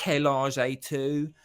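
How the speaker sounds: loud, drawn-out Cockney French; Cockney-accented French. Retold or imitated. Imitating a TV character's French, hammed-up Cockney. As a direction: mangle the French in broad South East London Cockney. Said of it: "South East London Cockney accent".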